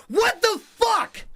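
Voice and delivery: high, loud stunned outburst; a sudden high cry of shock; shocked disbelief. Retold or imitated. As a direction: blurt it out in sheer disbelief. Stunned reaction to live death news.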